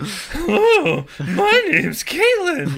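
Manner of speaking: very high, loud, slow squeaky voice; bad dubbed voice. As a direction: ham up a terrible dubbing voice. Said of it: "just a really bad".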